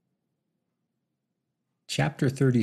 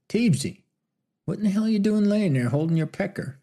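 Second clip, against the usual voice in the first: put-on old West gunman; 10 semitones higher than usual. Doc finding Teebs on the ground, amused fast teasing. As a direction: tease him as a bemused cowboy doctor. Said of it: "The voice did not come from any modern-day man".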